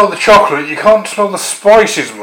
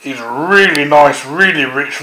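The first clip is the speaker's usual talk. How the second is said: ordinary talk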